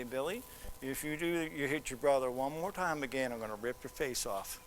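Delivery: low, hushed warning in church; soft, dropping, menacing undertone; quiet parental threat. Shown as a demonstration. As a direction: keep it down but threaten the child. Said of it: "hoping that he gets your inflection".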